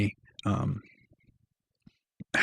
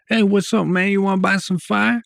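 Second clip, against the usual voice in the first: high, loud, pushy pitch of a stranger selling; street dealer voice; 10 semitones higher, 8 dB louder than usual. Retold or imitated. Stranger on the street pitching weed to the narrator, loud and high. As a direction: hail him like a dealer working the corner.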